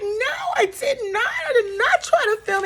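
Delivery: high, wailing fake-sob denial; mock crying denial. Drew denying to Porsha she filmed, in a high mock-crying wail. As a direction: ham up a teary protest. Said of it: "Drew's like".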